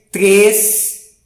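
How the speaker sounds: "três" loud and long with a closed "ay" vowel. Shown as a demonstration. Teacher reading Three Little Pigs, forceful word. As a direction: push hard on "três", mouth not too open. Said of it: "colocou mais força pra ler o três".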